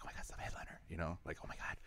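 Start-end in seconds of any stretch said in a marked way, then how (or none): 0.0-0.8 breathless starstruck whisper, almost no voice; awed whisper. Retold or imitated. Starstruck new host meets headliner. 1.4-1.8 breathless starstruck whisper, almost no voice; awed whisper. Retold or imitated. Starstruck new host meets headliner.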